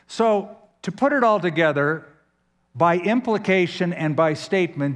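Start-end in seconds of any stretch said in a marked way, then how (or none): none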